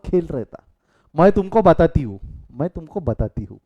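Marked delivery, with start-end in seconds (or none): 1.1-2.2 loud, sharp threat of a telling-off; angry wife's warning, said loud; scolding tone. Shown as a demonstration. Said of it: "फर्क है ना दोनों में". 2.5-3.6 a calm, friendly "I'll tell you"; easy and unhurried, no edge; gentle tone. Shown as a demonstration. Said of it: "फर्क है ना दोनों में".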